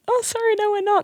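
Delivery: very high, polite refusal; apologetic service voice. Retold or imitated. Staff turning away customers after closing, high and apologetic. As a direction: imitate a sing-song customer-service apology.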